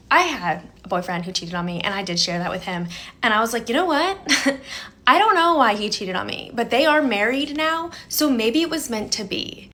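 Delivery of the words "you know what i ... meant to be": sarcastic brush-off. Retold or imitated. Answering her date about her cheating ex, pitched-up sarcastic breeziness. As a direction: brush it off with a laugh, over-bright.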